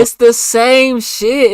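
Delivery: loud laughing agreement. Real, not acted. Cohost cracking up at the story, laughing loudly.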